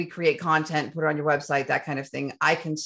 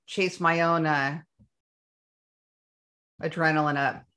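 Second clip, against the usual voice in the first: ordinary talk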